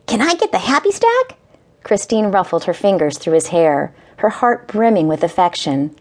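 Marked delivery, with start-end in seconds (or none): 0.1-1.4 put-on high, hopeful small boy; eager little boy. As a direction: ask it eagerly like a kid. Eager child asking.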